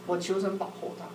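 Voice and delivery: ordinary talk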